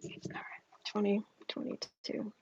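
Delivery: breathy whisper to herself. Real, not acted. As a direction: whisper it, concentrating. Focusing quietly before reading.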